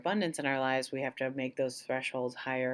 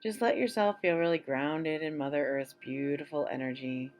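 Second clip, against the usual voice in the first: calm guided-meditation voice with long pauses; 2 semitones higher than usual. Acted. Leading listeners through an abundance meditation, unhurried meditation voice. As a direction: guide listeners gently into relaxation, phrase by phrase.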